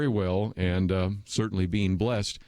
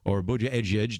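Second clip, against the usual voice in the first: ordinary talk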